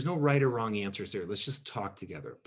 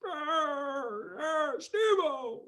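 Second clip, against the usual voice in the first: drawn-out, much higher imitation of an older man; father-in-law's voice; much slower than usual. Father-in-law starting a debate with his son-in-law, imitated voice. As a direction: stretch it out slowly like he did. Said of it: "he had this great voice".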